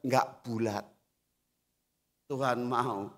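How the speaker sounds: usual speaking voice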